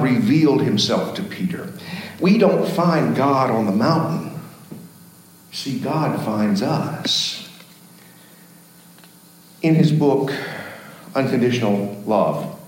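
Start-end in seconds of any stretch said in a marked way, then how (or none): 5.5-7.5 slow, quiet, deliberate; slow emphasis. Acted. Preacher telling the congregation the key point, slow and soft. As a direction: let each word land slowly.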